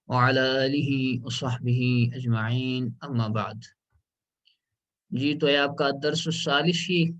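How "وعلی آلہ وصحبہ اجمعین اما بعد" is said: slow, formal Arabic blessing; measured religious opening before class; opening invocation. Real, not acted. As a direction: recite the opening praise with reverence.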